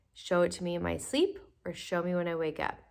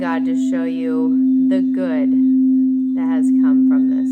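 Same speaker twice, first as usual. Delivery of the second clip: loud; 16 dB louder, slower than usual. Acted. Meditation leader calls out loudly.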